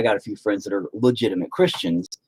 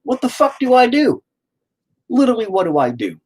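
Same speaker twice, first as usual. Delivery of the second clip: mock bewilderment; 14 semitones higher, 4 dB louder than usual. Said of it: "I'm all".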